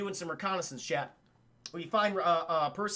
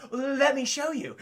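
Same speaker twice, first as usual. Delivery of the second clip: slow, higher, knowing; mock hero reveal; 5 semitones higher, 4 dB louder, slower than usual. Perseus about to reveal the severed hand, slow and knowing. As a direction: savor the reveal.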